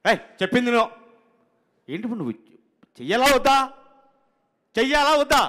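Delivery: high, loud, fast scolding; impatient director impression. Director scolding the assistant over five minutes, loud and fast. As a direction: tell him off fast and sharp. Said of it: "director గారు".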